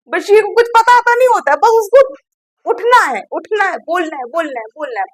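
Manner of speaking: high, exasperated mimicry that fades away; mock how he just jumps up to talk; mocking imitation. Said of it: "mocking Basheer's habit".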